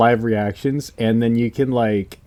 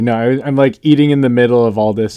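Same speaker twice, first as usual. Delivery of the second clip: ordinary talk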